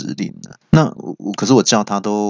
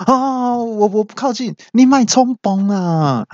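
very high, drawn-out mock alarm; hasty alarmed pleading; 13 semitones higher, slower than usual. Guard calms cornered man at elevator, alarmed. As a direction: play a flustered guard backing off. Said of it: "保全就赶忙说".

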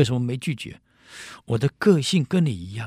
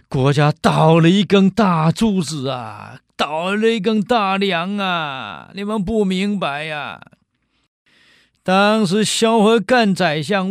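lamenting cry; 6 semitones higher, 5 dB louder than usual. Acted. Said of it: "曹参大哭啊".

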